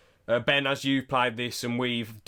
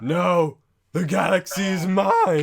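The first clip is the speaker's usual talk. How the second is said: louder snarling evil emperor; cold rasping evil emperor; 5 semitones higher, 7 dB louder, slower than usual. Retold or imitated. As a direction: roar it like a Sith lord. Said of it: "Then he goes".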